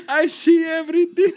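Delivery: high laughing genie voice. Retold or imitated. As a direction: mimic the genie's boast while laughing. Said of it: "he sound like a goddamn genie".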